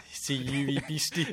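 slow, hammed-up broad Scottish; mock Robert Burns Scottish voice; broad Scottish accent. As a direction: play the haggis-toasting Scot. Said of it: "In my normal Scottish accent".

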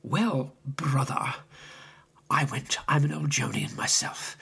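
hushed, confiding whisper. Retold or imitated. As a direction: lean in and whisper it like a secret between old friends. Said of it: "then he lowered his voice".

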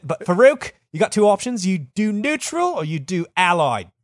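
bad British accent, mock fury; angry British accent. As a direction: scold him in a wobbly British accent. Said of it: "angry. I do the incorrect accent".

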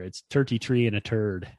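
leprechaun-y Irish accent; mocking Irish accent. Shown as a demonstration. Giving the co-host the winning Irish phrase, mock Irish accent. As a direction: say it in a teasing, stagey Irish accent. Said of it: "mocking Irish accent".

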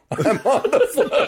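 high, drawn-out crowing through laughter; retold giddy boast; laughing gloat. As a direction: sing out to your friends, cracking up. Gloats as his group approaches.

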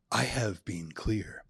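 slow, soft, solemn drama teacher voice; mock grave and drawn out, like a stagey teacher. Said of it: "his substitute drama teacher voice".

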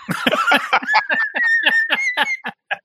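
squealing high laugh; high cackle. Real, not acted. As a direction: burst into a shrill cackle. Laughs at own joke.

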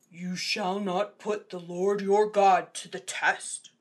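slow, grave, firm; solemn Jesus voice. Acted. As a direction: state it with calm authority. Jesus answering devil.

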